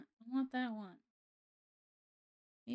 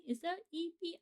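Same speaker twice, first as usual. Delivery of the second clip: high soft question; 7 semitones higher, 6 dB quieter than usual. Real, not acted. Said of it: "repeats the question".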